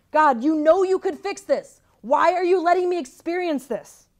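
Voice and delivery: pleading out loud to God in despair; crying out. Retold or imitated. Praying alone by her bed, crying out loud in anguish. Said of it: "I cried".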